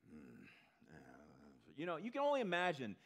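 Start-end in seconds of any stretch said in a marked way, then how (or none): 0.1-1.0 begrudging hmm. Retold or imitated. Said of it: "He's kinda begrudging in his response".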